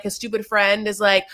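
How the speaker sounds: ordinary delivery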